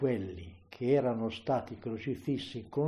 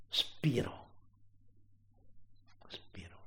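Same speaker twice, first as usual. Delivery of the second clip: whispered, fading almost to nothing; hushed dying breath; 4 semitones higher, 9 dB quieter than usual. A narrator's reading. Preacher narrating the death of Jesus, hushed whisper. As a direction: let the word expire in a whisper.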